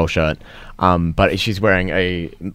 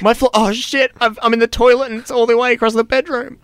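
much higher, panicked squeal; mock panic. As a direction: panic high and fast like losing it.

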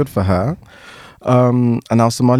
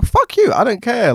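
high-pitched dismissive comeback; playful defiant retort; mock defiant; 10 semitones higher than usual. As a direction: throw the apology back, starting way up high. Acts out rejecting an apology.

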